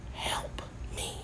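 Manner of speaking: tiny voiceless whisper; whispered plea. Pretend. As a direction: whisper it as a mock plea for help. Mock plea after creepy story.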